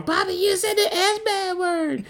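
put-on high, sing-song child accusation; imitating a tattling kid. Dad voicing the kids accusing him: high, sing-song child voice. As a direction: tease like a kid catching a parent out. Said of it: "Kyrie and Sinai talking".

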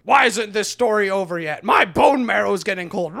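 loud, impatient orc complaint; hammed-up whiny orc; mock grumpy orc. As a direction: grouse loudly like a bored orc.

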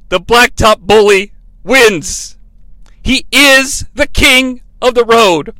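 slow, grand ring announcer; announcer proclamation. Acted. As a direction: declare the winner slowly.

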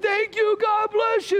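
very high, overjoyed thanks; gushing thanks. Retold or imitated. Pastor retelling how he thanked the plow driver, very high and gushing. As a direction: thank him like he saved your life.

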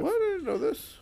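very high squeaky pitch. Real, not acted. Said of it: "I can do the high pitch right now".

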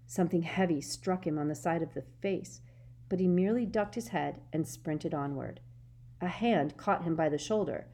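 ordinary delivery, nothing marked

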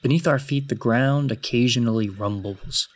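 measured, solemn tale-telling; story narration. A narrator's reading. Game master's formal scene opening.

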